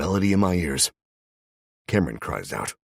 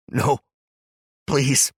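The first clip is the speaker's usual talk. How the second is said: desperate plea; 10 semitones higher, 7 dB louder than usual. Acted. Cameron begging at gunpoint.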